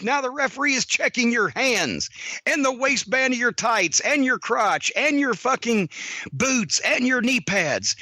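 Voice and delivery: ordinary talk, nothing marked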